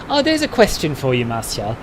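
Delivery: usual speaking voice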